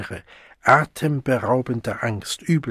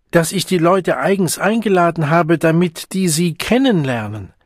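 high, louder bellow through the door; 6 semitones higher, 5 dB louder than usual. A narrator's reading. Siegmund bellowing at the locked door, high and loud. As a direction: yell it with all his might. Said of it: "aus Leibeskräfte brüllen musste".

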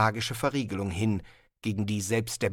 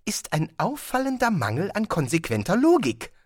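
higher, chatty, curious tourist voice; eager tourist Zweiblum; 7 semitones higher than usual. Acted. Tourist marvelling to Rincewind, higher and chatty. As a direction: play the puzzled, cheerful traveller.

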